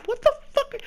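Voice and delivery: very high, loud squeal of disbelief; stunned outburst. Retold or imitated. Hearing the stranger repeat the claim, very high loud disbelief. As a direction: shriek it in total shock.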